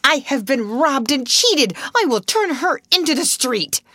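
a headmistress robbed, spitting fury; outraged gasp. A narrator's reading. Miss Minchin to the solicitor, outraged. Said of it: "Miss Minchin gasped".

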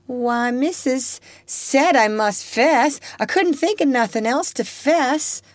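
a hint of a sly girl, innocent surprise; lightly voiced girl. Topsy's voice.

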